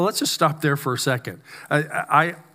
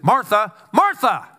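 "Martha, Martha" called out high and loud; sharp wake-up call; 5 semitones higher, 4 dB louder than usual. Retold or imitated. Jesus snapping a distracted Martha awake, sharp and loud. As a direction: snap her out of it by calling her name. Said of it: "Jesus would say it like this".

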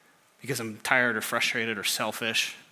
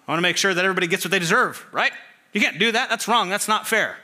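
raised, high, fast protest; indignant demand for justice; 7 semitones higher, 6 dB louder, faster than usual. Acted. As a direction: protest loudly that it isn't fair. Acting out wanting to control everyone.

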